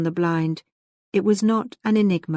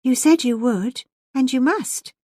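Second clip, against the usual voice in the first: put-on higher, insistent young lady; 7 semitones higher, 4 dB louder than usual. A narrator's reading. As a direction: insist on the promise, pitch lifted. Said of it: "insisted Elfride".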